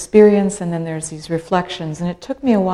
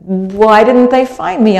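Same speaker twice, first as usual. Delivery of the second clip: baffled question; 4 semitones higher, 8 dB louder than usual. Retold or imitated. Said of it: "And I said".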